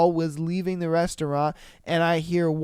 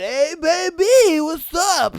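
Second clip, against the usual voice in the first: mock catcaller; 10 semitones higher, 8 dB louder than usual. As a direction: holler it like a hammed-up street catcaller. Imitating catcallers from the truck.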